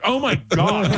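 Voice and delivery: high-pitched hammed-up shock; mock horror. Dermatologist seeing the patient's legs in the air, mock horror. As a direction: squeal it in mock horror, half laughing. Doctor shocked, teller cracking up.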